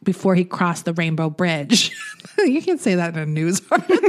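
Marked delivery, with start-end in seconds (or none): none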